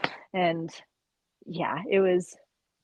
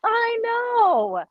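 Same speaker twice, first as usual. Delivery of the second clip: mock sob; 5 dB louder than usual. Crying mess reaction.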